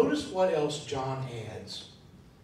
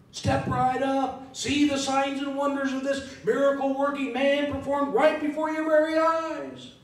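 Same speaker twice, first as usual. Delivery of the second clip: high, loud hammed-up sideshow barker; carnival barker; 9 semitones higher, 5 dB louder than usual. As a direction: sell the miracle like a circus barker. Carnival barker imagined at miracles.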